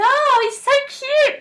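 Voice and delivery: high, loud, drawn-out fangirl squeal. Retold or imitated. Mimicking young girls over a boy band, high squeal. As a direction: squeal like a starstruck twelve-year-old. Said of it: "Like, screaming over that".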